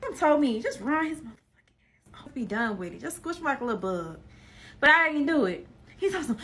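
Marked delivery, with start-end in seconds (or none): 0.6-4.2 retold hushed whisper, fading softer; whispered quote. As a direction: whisper it like he muttered it. Retelling what he told her.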